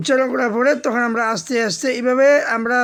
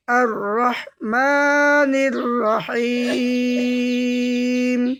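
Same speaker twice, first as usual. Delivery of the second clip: each word drawn out very slowly, one at a time; slow chanted Quran reading; broken slow recitation; much slower than usual. Shown as a demonstration. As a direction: recite word by word with long pauses. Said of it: "এবার ভাঙা ভাঙা উচ্চারণ করবেন".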